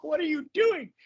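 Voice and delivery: very high, exasperated puppet cry; Grover. Acted. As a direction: scold the reader in a panicky puppet voice. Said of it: "I would do the Grover voice".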